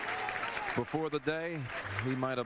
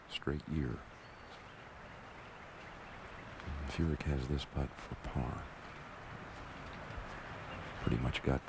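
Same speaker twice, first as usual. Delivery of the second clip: hushed, low golf commentary; golf commentator; 6 semitones lower, 4 dB quieter than usual. Real, not acted. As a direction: murmur it like a TV golf broadcaster.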